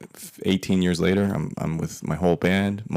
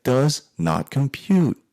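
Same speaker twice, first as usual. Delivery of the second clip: high, slow, drawn-out computer voice; baffled machine; 7 semitones higher, much slower than usual. Retold or imitated. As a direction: stretch it out like a mock robot stuck on an error. Acts baffled, like a malfunctioning machine.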